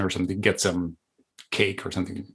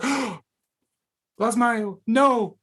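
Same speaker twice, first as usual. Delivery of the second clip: very high, laughing mock alarm; mock horror; 13 semitones higher than usual. Recognizing father's habit in himself, high laughing mock horror. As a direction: squeal it, half laughing at yourself. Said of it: "You know, I was like".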